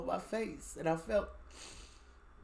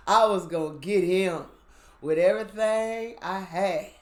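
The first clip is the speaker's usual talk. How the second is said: swearing revenge with everything you have; fierce vow; 3 semitones higher, 10 dB louder than usual. Retold or imitated. Vowing revenge on the killer.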